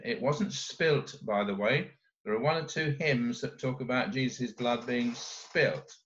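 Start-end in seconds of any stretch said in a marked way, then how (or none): none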